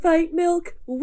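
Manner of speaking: high slogan chant breaking into a whoop; hammed-up ad cheer, leaping up on the whoop; mock hype cheer. Friend echoing the show's drink slogan, high mock cheer.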